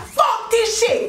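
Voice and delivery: high, slow, drawn-out furious outburst; acted-out high-pitched angry yell; screaming. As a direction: imitate him exploding, stretching each word. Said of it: "he is screaming".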